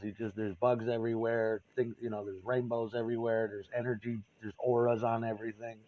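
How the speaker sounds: ordinary talk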